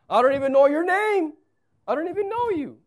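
high, loud complaining Moses; Moses objecting. Acted. Moses telling God he doesn't know him, high and whiny. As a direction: whine it up high. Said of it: "Second objection".

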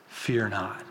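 soft and low, gently spoken; tender. Retold or imitated. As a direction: say it like quiet comfort to a frightened man. Jesus gently speaks to Simon.

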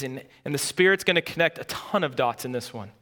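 usual speaking voice

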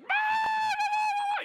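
very high, loud sustained shriek; mock demonic scream. Carla in the fourth row freaking out, mock screaming. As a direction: suddenly shriek like someone possessed. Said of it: "she's like".

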